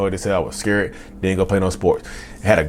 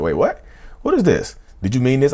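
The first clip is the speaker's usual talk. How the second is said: surprised; 7 semitones higher than usual. Retold or imitated. Shocked reading search result.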